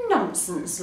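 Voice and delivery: ordinary delivery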